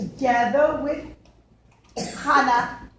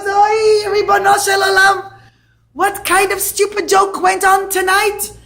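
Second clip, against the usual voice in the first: high, loud wailing cry to heaven; anguished cry; 7 semitones higher, 8 dB louder than usual. Acted. Father crying out over the marriage contract, loud wail. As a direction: cry out to God in despair.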